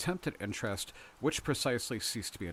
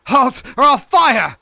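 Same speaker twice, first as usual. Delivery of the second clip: very high, full-force shout; slow, loud shouted order; cried warning; 21 dB louder, slower than usual. A narrator's reading. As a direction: yell a warning after a fugitive. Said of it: "He cried".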